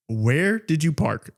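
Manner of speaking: slow, separated words in "Where did you park"; carefully enunciated. Retold or imitated. Dictating a text to Siri, carefully enunciated. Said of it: "I made sure I enunciated".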